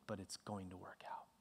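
whispered reassurance. Real, not acted. Preacher reassuring the church, whispered. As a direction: whisper it as a promise.

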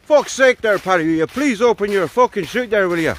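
exasperated, high-pitched Irish pleading; terrible Irish accent. Acted. Murphy begging Paddy at five hundred foot, exasperated mock Irish accent. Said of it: "apologies for the terrible accent".

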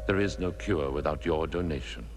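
usual speaking voice

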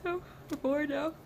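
yawning. Real, not acted. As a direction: talk right through a big yawn. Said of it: "Sorry for the yawn".

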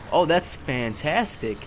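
loud, slow sarcastic praise; sarcastic fantastic. Real, not acted. Gamer mocking own failed jump, loud slow sarcasm. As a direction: drag it out, dripping sarcasm.

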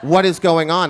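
loud angry question; angry demand. Retold or imitated. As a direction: demand answers from the teacher. Angry principal confronts teacher.

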